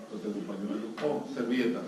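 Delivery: normal talking voice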